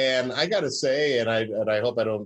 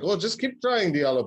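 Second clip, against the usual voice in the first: put-on German accent, pitched way up; Otto's German accent; 7 semitones higher than usual. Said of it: "that was my best German accent".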